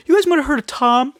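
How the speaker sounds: high-pitched name-dropping son of a famous dad; coattail-riding rich son. Acted. Famous actor's son bragging about his family, mock high voice.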